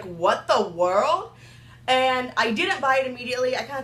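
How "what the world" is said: drawn out. Retold or imitated. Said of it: "and I was like".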